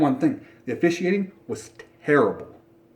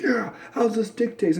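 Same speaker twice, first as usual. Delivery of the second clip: mock boasting; 8 semitones higher than usual. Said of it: "he sits there and boasts".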